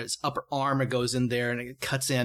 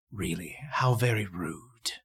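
annoyed villain; 6 semitones lower, slower than usual. A narrator's reading. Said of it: "almost looks annoyed at you".

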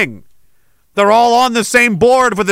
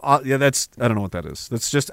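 ordinary delivery

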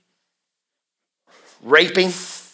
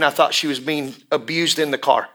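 ordinary delivery

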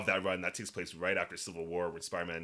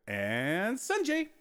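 announcer voice; 11 semitones higher, much slower than usual. Acted. Outro voice drawn out on name.

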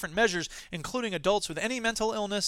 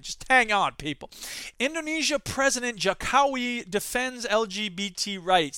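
ordinary talk

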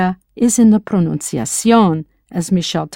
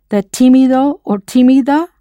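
ordinary talk, nothing marked

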